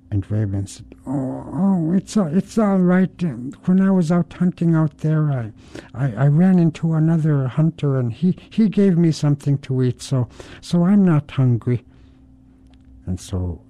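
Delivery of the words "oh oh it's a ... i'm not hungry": higher, breezy trickster voice; Raven's voice. Acted. Raven reassuring his wife Mink, higher breezy trickster voice. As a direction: brush it off lightly in a higher voice.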